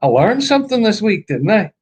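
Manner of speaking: high, playful Irish sing-song; pleased with yourself, Irish style; mock Irish accent. Recalling her lesson, a mock Irish accent. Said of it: "I thought".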